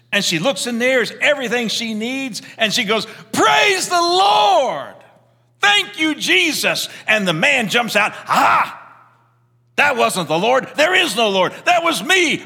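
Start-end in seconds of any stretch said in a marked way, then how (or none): 3.3-6.9 high, slow, stretched-out shout of thanks; joyful shout. Retold or imitated. Woman finds groceries on porch. 8.3-12.4 high, loud gotcha cry; spring out of the bushes and crow; gloating shout. Retold or imitated. Neighbour jumps out of bushes.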